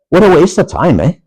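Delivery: Upper Michigan Yooper accent, high and lilting; Youper accent. Real, not acted. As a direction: let the Yooper accent slip out, ending on a lilting eh. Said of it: "my Youper accent coming out".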